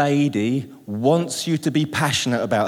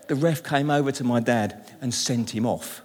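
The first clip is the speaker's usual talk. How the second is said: ordinary talk, nothing marked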